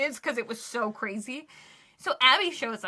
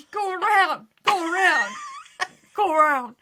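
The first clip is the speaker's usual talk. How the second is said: loud, higher mock traffic-director yell; retold distraught shouting; 6 semitones higher, 6 dB louder than usual. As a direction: call out over cars, acting frantic. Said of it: "I'm sobbing".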